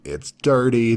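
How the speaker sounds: ordinary talk, nothing marked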